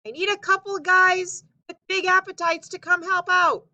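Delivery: loud, high shouting stranger; calling man. Acted. Stranger calling to Paul and Babe for help, loud and high. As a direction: keep hollering up the request.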